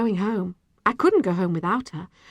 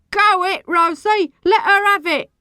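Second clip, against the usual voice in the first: high, loud egging-on; rowdy heckler's yell; encouraging shout; 12 semitones higher, 8 dB louder than usual. A narrator's reading. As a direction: cheer a fighter on from the crowd. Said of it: "shouted encouragingly".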